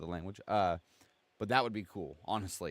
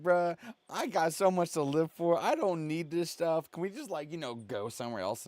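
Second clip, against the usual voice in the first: higher, pleading scared voice; whiny scared Corey; 7 semitones higher than usual. Retold or imitated. Mocking Corey begging the spirit to let them leave, high and pleading. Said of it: "Corey's over here like".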